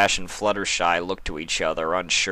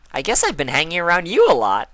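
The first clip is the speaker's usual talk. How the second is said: bubbly, higher pony voice; perky and upbeat; Pinkie Pie voice; 10 semitones higher, 6 dB louder, faster than usual. Acted.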